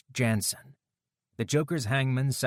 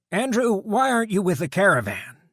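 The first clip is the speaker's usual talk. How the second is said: biker deputy voice; 12 semitones higher, 7 dB louder than usual. A narrator's reading. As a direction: coolly call out the guard's threat. Dutch voiced.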